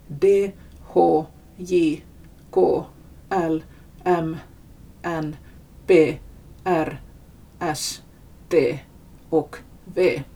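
letter names said singly with pauses; Finnish consonant names. Shown as a demonstration. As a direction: recite Finnish consonants one by one. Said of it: "läser man upp så här på finska".

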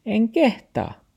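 high, slow refusal; talking dog. A narrator's reading. Dog makes excuses to grandfather, high and slow.

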